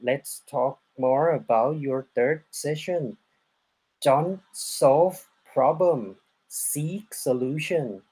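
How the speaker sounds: very slow, deliberate question to the guest; slow careful English. Real, not acted. Host asking the guest about her second session, slow and deliberate. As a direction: spell each word out slowly for a non-Thai guest.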